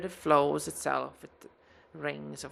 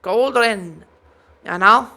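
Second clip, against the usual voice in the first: high, louder name repeat; dwarf smith voice; 5 semitones higher, 13 dB louder than usual. Acted. Dwarf repeating her name to players, high and loud. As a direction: correct them brightly in character.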